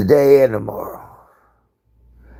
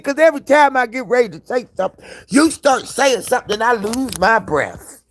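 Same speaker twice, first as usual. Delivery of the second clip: much higher and fast, running out of air; breathless excited retelling; 11 semitones higher than usual. Retold or imitated. Answering the friend on the phone, high and breathless. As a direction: rush it like you're out of breath. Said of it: "I said".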